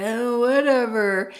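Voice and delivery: slow, drawn-out sulky whatever; teen whatever. Retold or imitated. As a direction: play a dismissive thirteen-year-old. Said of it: "adolescent thirteen-year-old behavior".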